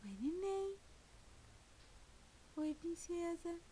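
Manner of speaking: soft cooing. Real, not acted. Mother cooing softly to baby.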